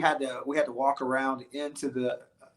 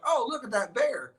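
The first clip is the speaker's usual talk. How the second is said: high-pitched mock marvelling; imitated onlookers; 9 semitones higher than usual. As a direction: play distant friends spotting a bear. Voices bandmates watching from hill.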